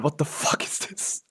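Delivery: slow, breath-starved astonishment; stunned disbelief. Real, not acted. Gamer stunned by a great roll, slow disbelief. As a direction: drag it out, voice giving way in shock.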